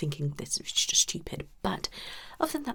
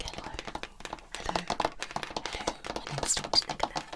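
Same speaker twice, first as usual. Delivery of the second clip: mock ASMR whisper; 3 dB quieter than usual. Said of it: "That's all that tapping and whispering, isn't it? Like".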